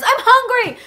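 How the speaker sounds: loud, high-pitched mock kid whine; high hungry-kid complaint, hammed up; whiny little brother. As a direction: imitate a demanding hungry child. Little brother whining for food.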